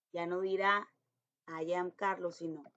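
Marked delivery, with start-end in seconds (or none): none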